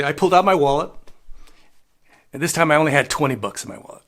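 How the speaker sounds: ordinary talk, nothing marked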